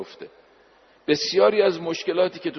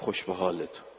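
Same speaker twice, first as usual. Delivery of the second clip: soft and lower, almost to himself; said softly; 8 semitones lower, 7 dB quieter than usual. Retold or imitated. Sage to the ailing pilgrims at the shrine, said softly. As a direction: murmur it gently, lower. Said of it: "آروم گفت، آهسته گفت".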